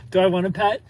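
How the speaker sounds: ordinary talk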